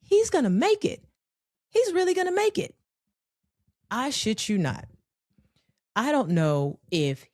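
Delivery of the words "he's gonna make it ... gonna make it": high, fast cheering, willing him over the fence; root for him at the top of your voice; excited hopeful cheering. Retold or imitated. Friends cheering a boy jumping his bike. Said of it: "And we're like".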